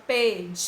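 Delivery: long held DI in "pedido"; stressed syllable drawn out. Shown as a demonstration. Teacher showing pupils the stressed syllable, DI held long in "pedido". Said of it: "A tia demorou mais no de".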